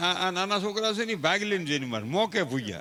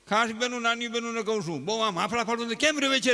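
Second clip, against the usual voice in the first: ordinary delivery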